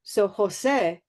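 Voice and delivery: Jose as HO-se, pure vowel at the end; Jose with a flat Spanish e. Shown as a demonstration. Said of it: "take Jose".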